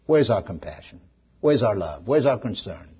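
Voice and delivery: normal talking voice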